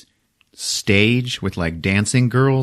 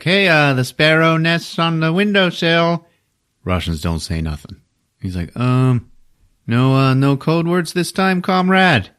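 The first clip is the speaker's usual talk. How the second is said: nervous high voice; 8 semitones higher than usual. Retold or imitated. Bob nervous with Russians.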